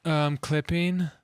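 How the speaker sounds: slow, high, moaning drawl. Shown as a demonstration. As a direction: moan the words out slowly. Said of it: "in moaning voice".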